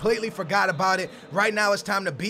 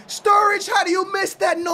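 high loud anguished shout; frustrated cry; 10 semitones higher, 4 dB louder than usual. Real, not acted. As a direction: howl at the miss. Reacting to missed chance.

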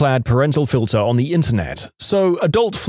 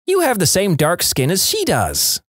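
higher-pitched mock preschooler; small child voice; 6 semitones higher than usual. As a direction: imitate a little kid speaking up. Narrator voices herself as a preschooler.

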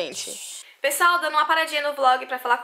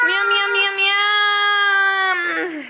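playful munching; 6 semitones higher, 4 dB louder, much slower than usual. Pretend. Mom mimics eating noises for kid.

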